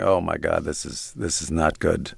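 low, soft, sinking dismay under the breath; quiet horrified realization; dismayed mutter. Retold or imitated. Editor watching the footage, softly dismayed. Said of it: "I'm going".

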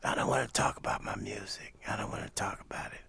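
soft, breathless greeting; imitating Miles's whispered rasp; raspy whisper. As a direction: rasp it barely above air.

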